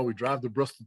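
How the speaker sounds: usual speaking voice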